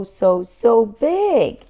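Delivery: slow, stretched wonder on so, so big; drawn-out awe. Acted. George marvelling at the shop window dinosaur, slow awe. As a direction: let the size sink in, slowly.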